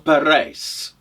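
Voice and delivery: "Parijs" said pa-RAYS; Flemish pronunciation. Acted. Traveller naming his city, Flemish pronunciation. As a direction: say "Parijs" with stressed "rays" ending. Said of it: "the Flemish pronunciation for Paris".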